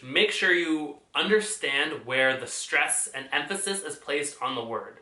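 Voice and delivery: ordinary talk, nothing marked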